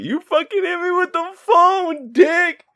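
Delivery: very high, slow whiny wail; whiny Stu cry. Retold or imitated. Friend replaying Stu's phone line, very high wail. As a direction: wail it like a hurt child. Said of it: "best part of this whole movie".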